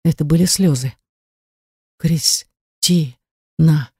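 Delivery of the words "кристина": very slow name broken into syllables; soundless, faltering. A narrator's reading. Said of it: "беззвучно произнёс он".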